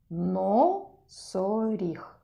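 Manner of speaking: Носоріг stretched syllable by syllable, very slow. Shown as a demonstration. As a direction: draw Носоріг out as no-со-ріг so the first syllable is heard. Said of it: "На який склад починається це слово?".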